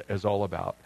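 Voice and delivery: ordinary talk, nothing marked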